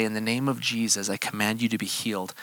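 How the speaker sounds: put-on quiet words over a three-week-old baby; hushed prayer. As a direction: lay it over the baby softly. Same command over a sick baby.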